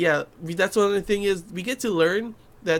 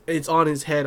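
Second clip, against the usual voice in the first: ordinary talk, nothing marked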